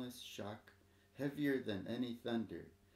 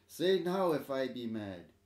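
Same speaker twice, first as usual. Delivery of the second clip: calling out; 3 semitones higher, 8 dB louder than usual. Acted. Old man calls to the crowd.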